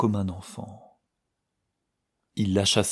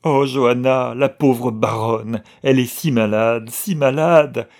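tearful cry; 3 semitones higher, 7 dB louder than usual. A narrator's reading. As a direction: pour out her grief in a loud sob-laden cry. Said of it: "s'écria-t-elle ... secouée d'une crise de larmes".

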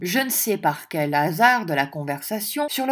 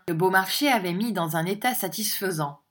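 ordinary delivery

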